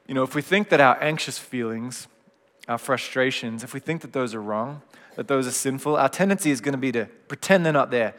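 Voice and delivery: normal talking voice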